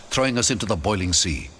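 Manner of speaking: ordinary delivery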